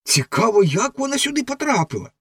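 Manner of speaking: put-on high, lively boyish voice; a boy wondering aloud at the stick in the bog; excited boy. A narrator's reading. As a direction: point it out with curiosity. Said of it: "вигукнув один із хлопців".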